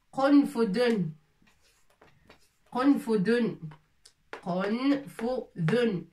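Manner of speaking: "Konfudon" said slowly with the tongue between the teeth on the d; slow drilled word. Shown as a demonstration. Teacher drilling the word for the class, slow. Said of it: "On sort un petit peu la langue".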